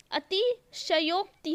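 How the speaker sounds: "अतिशयोक्ति" slowly as ati-sha-yok-ti; full sha. Shown as a demonstration. Teacher fixing a common mistake, slow and enunciated. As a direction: give "अतिशयोक्ति" a whole sha syllable. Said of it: "आधा श नहीं आता".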